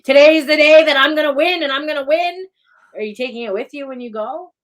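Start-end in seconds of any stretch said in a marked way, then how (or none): 0.0-2.5 high, loud, fired-up declaration; pumped-up vow. Pretend. Imagining waking up angry, a loud fired-up vow. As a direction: shout it like a battle cry. Hyped morning vow, acted.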